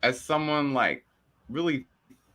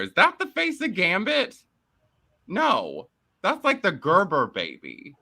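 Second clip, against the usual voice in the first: mocking disbelief; 8 semitones higher than usual. Real, not acted. Mocking actor's look.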